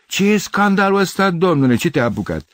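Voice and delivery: hoarse indignant shout. A narrator's reading. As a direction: shout down from a window at a nuisance. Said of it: "strigă răgușit".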